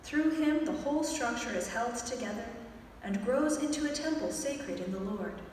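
usual speaking voice

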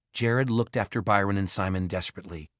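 plain reading voice

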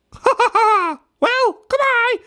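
very high cackling goodbye; laughing kookaburra. Acted. Cookie laughs a high goodbye to Clemmy. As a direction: cackle off like a giddy bird.

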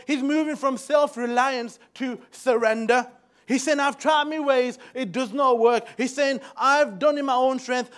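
loud, high, fervent preaching; rally the church with fire; impassioned sermon; preaching at full force. Acted.

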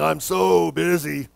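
low, slow, dragged-out mock groan; mock busy person. Shown as a demonstration. Answering somebody in the community, mock weary. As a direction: ham up the weary complaint, stretching "so". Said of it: "Instead of saying".

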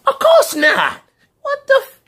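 very high, loud shriek. Acted. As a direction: shriek the denial. Dad shrieking.

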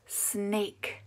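usual speaking voice

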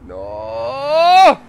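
high, loud crying no; anguished cry. Real, not acted. Gamer watching his car go over, anguished cry. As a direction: wail at the crash.

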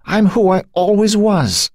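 high, drawn-out anguished insistence; distraught protest to himself; silent inner cry. A narrator's reading. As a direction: insist on it desperately, stretching the words. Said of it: "he cried silently to himself".

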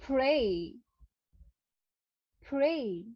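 প্রে said as pray, with the e gliding into i; correct pronunciation. Shown as a demonstration. Teacher models the word pray for the class. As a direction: glide the end of প্রে into an ee. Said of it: "এটার exact উচ্চারণ হবে".

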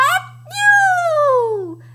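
huge high, loud "achoo"; hammed-up dinosaur sneeze; pretend sneeze. A narrator's reading. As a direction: explode into a long storybook sneeze. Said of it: "That sneeze blew Edna out".

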